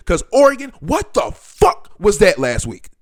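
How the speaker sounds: loud, high exasperated outburst; real fed-up fan yelling; exasperated rant. Real, not acted. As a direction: vent at the team in disbelief. Ranting at own team.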